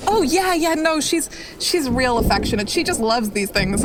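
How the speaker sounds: a cheerful boat woman downplaying the beast; fisherwoman character. Woman insists creature is friendly.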